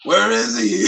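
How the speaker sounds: Batman voice. Retold or imitated. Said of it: "That's what you sound like".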